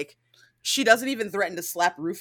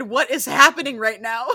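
high, loud baffled exclamation; amused, exaggerated disbelief; bewildered outburst; 5 semitones higher, 7 dB louder than usual. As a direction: relive your total confusion, laughing after. Retelling own bewildered reaction.

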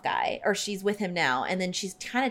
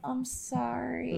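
soft, high, drawn-out sorry; slow, small-voiced mock regret; feeble apology; 4 semitones higher, 9 dB quieter, much slower than usual. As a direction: imitate a sheepish apology. Imitates a weak apology.

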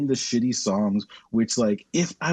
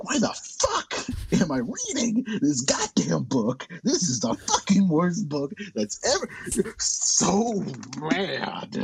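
higher-pitched, ranting outrage; exasperated anger; 5 semitones higher, slower than usual. Acted. Disbelieving reader rants about having to read this book, exasperated. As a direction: rant with punched, swooping curse words. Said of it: "you go to the other layer".

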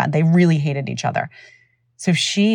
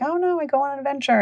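put-on high, quick kid voice; little boy; 9 semitones higher, 3 dB quieter, faster than usual. Boy explains his escape to parents, imitated high child voice. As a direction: mimic an innocent child explaining himself. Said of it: "that's what he's like".

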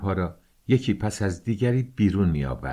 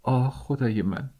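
moaning; 4 semitones higher than usual. A narrator's reading. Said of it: "دختر ناله کرد".

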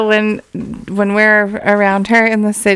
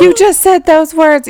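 scandalized cousin; 8 semitones higher, 4 dB louder than usual. Retold or imitated. Shocked cousins scold.

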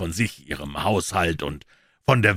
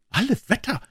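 higher-pitched eager pawnbroker; Wilson's voice. A narrator's reading. Wilson, the pawnbroker, answering Holmes, in a higher voice. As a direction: pitch him up as the earnest tradesman.

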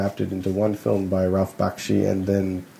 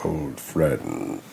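wizard voice; 10 semitones lower, 4 dB quieter than usual. Retold or imitated.

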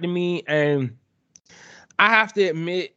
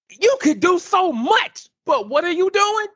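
pitched way up, incredulous; exasperated plea; 12 semitones higher than usual. Real, not acted. Exasperated plea to the game developers.

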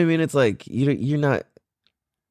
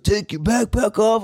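put-on dopey drunk; 9 semitones higher than usual. Voicing drunk dude.

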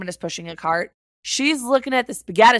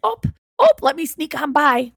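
exaggerated Wisconsin accent; 5 semitones higher than usual. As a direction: play up the Wisconsin accent while squeezing past. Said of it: "with such a heavy accent".

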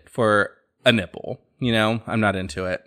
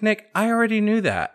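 higher, a light imitation of mom who already knew; mom's knowing reply; 11 semitones higher than usual. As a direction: tell him you saw it coming, in a lifted mock mom voice. Mom answers his coming out.

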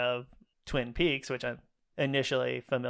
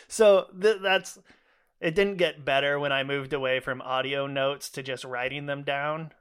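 usual speaking voice

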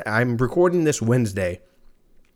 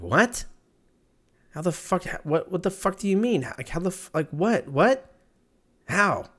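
high, stunned what-the-hell questions; shocked disbelief; 8 semitones higher than usual. Acted. Friend hearing Andrew died, pitched-up stunned disbelief. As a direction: reel from news of a friend's death.

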